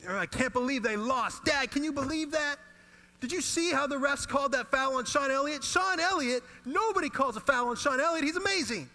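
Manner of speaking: put-on high, loud childish complaining; whiny kid rant. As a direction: rant like an upset little kid to dad. Kid ranting to dad after loss.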